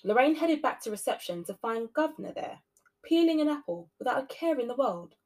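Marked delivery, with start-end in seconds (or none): none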